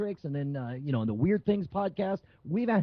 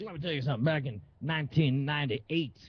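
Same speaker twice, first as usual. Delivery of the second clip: lightly voiced washed-up bragger, a little low; a hint of the guy boasting about his glory days; office party has-been; 3 semitones lower than usual. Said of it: "the guy at the office party would be like".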